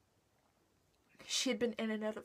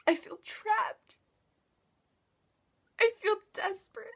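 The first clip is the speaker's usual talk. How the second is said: high, anguished cry building louder; desperate, crying outburst at a raised pitch; tearful despair; 7 semitones higher, 11 dB louder than usual. Acted. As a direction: break into loud, tearful despair. Confesses despair after failed attempts.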